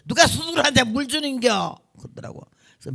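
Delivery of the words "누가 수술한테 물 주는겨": imitated scolding nurse, high and loud; mock indignant outburst; irritated refusal. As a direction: scold the patient for asking, acted out. Acted nurse refusing water.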